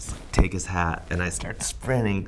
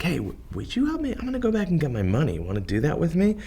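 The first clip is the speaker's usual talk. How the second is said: high, quick eager ask; higher, faster plea to a new friend; 10 semitones higher, faster than usual. Retold or imitated. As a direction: rush out a hopeful plea. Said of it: "I'm like".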